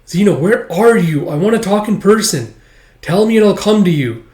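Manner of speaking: put-on girl pleading to meet Zeno in person; urgent pleading. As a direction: beg him to answer you.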